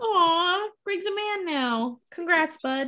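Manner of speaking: high, cooing congratulations; mock doting. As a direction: coo it high, like fussing over a grown-up kid. Teasing congratulations to graduated friend.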